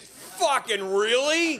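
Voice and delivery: high, drawn-out disbelief; incredulous outburst. Acted. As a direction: stretch it out loud and high in mock disbelief. Mock disbelief at holiday choices.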